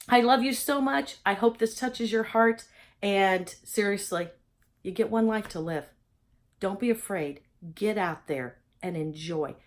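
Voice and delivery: ordinary talk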